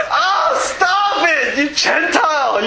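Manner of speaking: laughing mock scold; mock exasperated. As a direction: throw it out with a laughing protest. Mock outburst at girls' advice.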